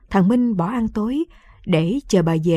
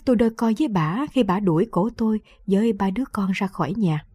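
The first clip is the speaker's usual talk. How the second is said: ordinary delivery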